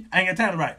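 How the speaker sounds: ordinary delivery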